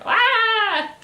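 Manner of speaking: loud, high mock scream; hammed-up shriek of someone freaking out; freaked-out scream. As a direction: imitate a startled high scream. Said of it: "the morgue lady freaks out".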